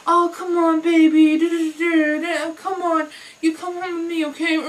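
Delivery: sing-song leering tease; mocking coworkers. Coworkers teasing the speaker, a loud sing-song come-on. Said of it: "then they're like".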